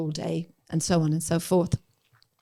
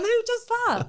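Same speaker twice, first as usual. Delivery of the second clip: high, incredulous squeal through laughter; mock roaring laughter. Friends joking about tips for leftover wine, mock roaring laughter. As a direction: imitate cracking up at a ridiculous idea. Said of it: "we'd be roaring laughing, going".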